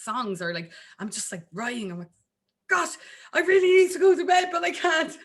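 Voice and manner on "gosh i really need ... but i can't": high, loud, rushed wail; hammed-up helpless plea, breaking toward a laugh; mock desperation. Acts out herself compelled to write.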